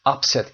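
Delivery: "Upset" as UP-set, the noun; stress on first syllable. Shown as a demonstration. Teacher showing noun stress, "Upset" stressed on UP. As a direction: hit the UP in "Upset". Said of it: "استرس در قسمت اول میاد".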